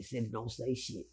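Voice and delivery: hushed warning. Retold or imitated. Warning his cellmate in a hushed voice. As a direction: keep it down so nobody hears.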